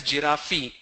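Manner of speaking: "giraffe" with an extra ee on the end; common mispronunciation. Shown as a demonstration. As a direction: say "giraffe" as three syllables, ji-raf-ee. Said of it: "Lot of people pronounce that as".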